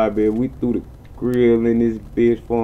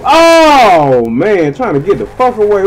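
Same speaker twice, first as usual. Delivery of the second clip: higher, louder rushed shouting; frantic yell; 9 semitones higher, 9 dB louder than usual. Real, not acted. Fleeing the police in the game, frantic yell. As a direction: yell while trying to escape.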